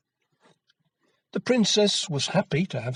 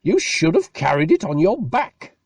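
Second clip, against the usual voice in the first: mild scold; 4 semitones higher, 5 dB louder than usual. A narrator's reading. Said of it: "shouted his mother".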